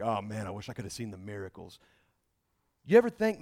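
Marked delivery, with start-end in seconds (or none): 0.0-1.8 wistful. Retold or imitated. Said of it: "You ever look back like".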